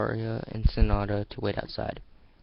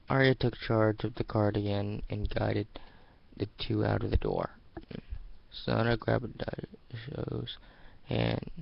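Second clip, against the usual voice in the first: usual speaking voice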